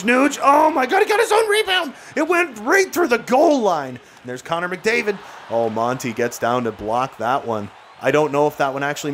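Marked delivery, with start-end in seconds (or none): 0.0-3.9 much higher, louder, panicked call; frantic high commentary shout; excited shout. Real, not acted. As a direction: yell it as the puck bounces loose. Frantic play near own net.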